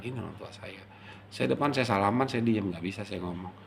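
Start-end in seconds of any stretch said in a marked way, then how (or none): 0.0-0.8 soft, inward retold murmur; murmured to himself. Son facing his parent, murmuring in his heart. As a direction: let it drop to a private hush. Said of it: "selalu bergumam aja gitu".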